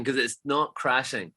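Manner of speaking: ordinary delivery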